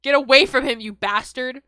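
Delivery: loud, furious yell at the monster; angry snap. Acted. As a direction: scream it at him to get away. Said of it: "I snapped".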